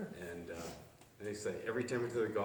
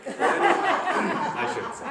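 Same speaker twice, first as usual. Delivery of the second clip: laughing; 11 dB louder, much slower than usual. Real, not acted.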